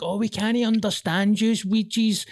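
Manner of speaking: high-pitched mock complaint; mocking complainers. Glaswegian mimicking people who can't understand Weegies, mocking. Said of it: "everybody's like".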